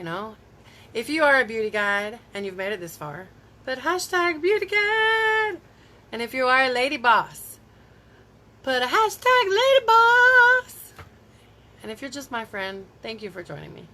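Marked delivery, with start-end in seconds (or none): 3.6-5.6 high, slow drawn-out cheer; rallying cheer. Real, not acted. Cheering her viewer group on. 8.6-10.9 high, loud, slow hype call; rallying cheer. Real, not acted. Cheering her viewer group on.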